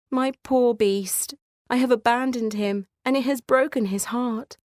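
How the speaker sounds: Beauty lamenting her abandoned beast; grieving Beauty. Acted. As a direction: mourn what you have done.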